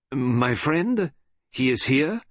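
put-on high, questioning Belgian accent; Belgian detective. Detective asks after his captured friend, Belgian-accented question.

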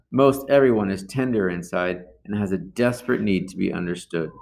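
normal talking voice

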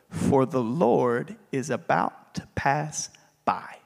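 low, slow whisper; whispered promise that the Lord will pass by; hushed whisper. Acted. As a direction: breathe it out like a secret.

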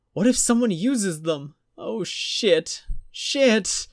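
high, frantic swearing; panicking woman. A narrator's reading. Jennifer panicking over her lost wallet, high and frantic.

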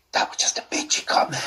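breathy, voiceless whisper; hushed, whispered admission; whispered aside. Real, not acted. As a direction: whisper it like a confession under your breath. Whispered aside on own remark.

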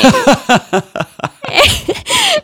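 high hearty laugh, retelling his laugh; imitated laugh. Retelling the Russian man's laughter at her name, high laugh. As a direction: laugh like the amused man did. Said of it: "he starts laughing".

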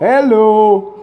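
echoey hello through a toy mic; toy echo microphone. Real, not acted. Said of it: "talk with an echo".

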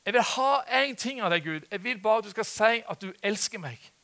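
re-enacted prayer, pressing each word; insistent plea to God. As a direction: plead stubbornly with God, building in force. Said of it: "Men jeg sa til Gud".

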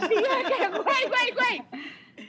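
high squeal of güey through laughter; laughing panic. Retold or imitated. Retelling bumping into her ex, squealing with laughter. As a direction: giggle the words out high.

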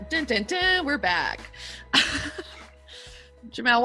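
ordinary talk

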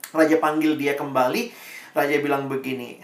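normal talking voice

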